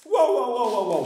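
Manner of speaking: loud, high alarmed whoas; frightened man crying whoa; panicked whoa. Acted. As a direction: stop him in a panic. Man begging snake in panic.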